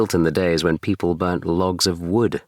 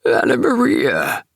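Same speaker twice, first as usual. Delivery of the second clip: high, sharp rat squeak rising to a shriek; 9 semitones higher, 4 dB louder than usual. Acted. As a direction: call her like a squeaking old rat. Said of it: "squeaked the rat".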